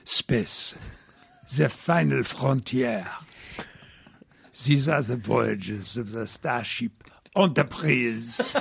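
Clouseau-style French accent, slow; French accent read slowly, breaking into a laugh; mock French accent. Shown as a demonstration. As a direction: ham the French-accented voiceover. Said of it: "Inspector Clouseau".

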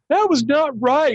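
mock scolding telemarketer. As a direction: drag it out, high and half-laughing.

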